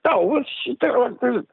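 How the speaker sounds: high, fast, incredulous protest; indignant protest. Retold or imitated. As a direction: scoff at the doctors in a high rush. Defending his heart to doctors.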